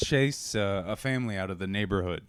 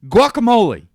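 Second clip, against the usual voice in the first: loud, high, slow mangled Spanish; hammed-up Spanish, drawn out and shouted; dad's bad Spanish; 14 dB louder than usual. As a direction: bellow broken tourist Spanish at a waiter. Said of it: "my dad at a Me-, Mexican restaurant".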